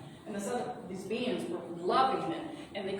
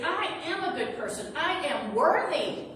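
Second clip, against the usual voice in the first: Meg standing firm; 7 semitones higher, 6 dB louder than usual. Acted. Meg holding her faith against the being, higher and firm. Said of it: "To say".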